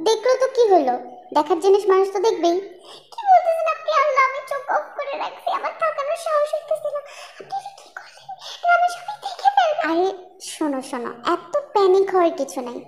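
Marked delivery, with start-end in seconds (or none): 3.1-9.8 embarrassed giggling. Acted. Girl embarrassed, eyes shut.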